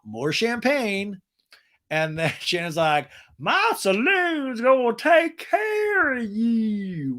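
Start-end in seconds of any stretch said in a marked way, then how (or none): 3.4-7.2 high, very slow Texas drawl; mock Texas accent. Shannon promising the ladies, in a mock Texas accent. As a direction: purr it like a saloon host. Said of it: "Shannon's Texas accent".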